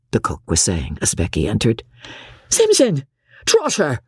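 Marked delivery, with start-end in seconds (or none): 2.5-4.0 high-pitched angry summons; great wrath. A narrator's reading. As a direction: call the servants crossly, pitched up. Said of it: "Missus Crawley cried in great wrath".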